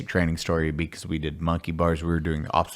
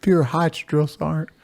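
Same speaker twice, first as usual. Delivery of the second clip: higher, meek private voice; private answering; 8 semitones higher than usual. Retold or imitated. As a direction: mumble the excuse up at the sergeant. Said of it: "So I would".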